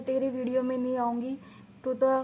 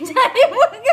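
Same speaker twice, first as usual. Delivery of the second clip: हँसते हुए; 10 semitones higher, 12 dB louder than usual. Real, not acted. Mummy पर हँसते हुए मज़ाक.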